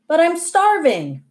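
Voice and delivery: high-pitched protest with a falling wail; whiny protest. A narrator's reading. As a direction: complain like a hungry kid. Hungry boy protests to mother.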